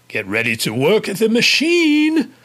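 hammed-up scary English accent. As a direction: imitate a crazy English supervisor, rising on "machine". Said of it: "doing this really scary English accent".

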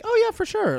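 very high, loud imitation of friends agreeing; eager agreement. Twins agreeing to let a friend in, high and eager. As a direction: pitch it way up, instantly on board.

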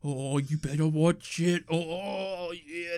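cranky grumbling. Retold or imitated. Son stewing over mum's cheating, cranky mock grumbling. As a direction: grumble slowly to yourself, playing cranky. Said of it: "I was so cranky".

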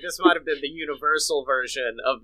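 ordinary delivery

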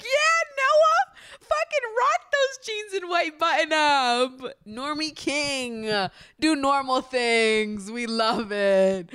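high, loud, drawn-out fan cheer; hammed-up cheering. Fan egging on Noah in his normie outfit, over-the-top cheering. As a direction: holler mock-adoring support, stretching the words.

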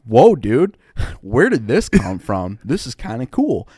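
loud laughing "whoa" and then chuckling through the words; delighted, laughing astonishment at a junior Marine; amused surprise. Retold or imitated. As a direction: laugh in disbelief as you watch it. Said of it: "And I'm like".

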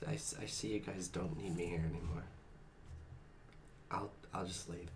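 hurt, low, mopey murmur. Acted. Hurt secretary sulking.

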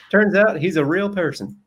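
high-pitched Southern voice; Southern accent. Acted. Host voicing his client as a real-person vouch, Southern accent. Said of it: "you were Southern then".

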